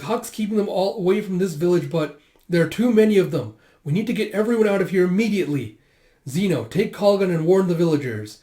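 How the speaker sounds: rally them to leave at once; urgent. Retold or imitated. Ordering an evacuation before soldiers arrive, urgent.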